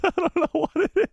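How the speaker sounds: high-pitched, laughing through the words; words breaking up in laughter. Real, not acted. Streamer amused by their own odd talent, laughing as they speak.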